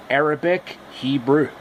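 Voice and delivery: usual speaking voice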